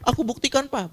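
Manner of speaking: put-on high-pitched child's pledge; earnest child. Child promising to prove himself to Papa, eager.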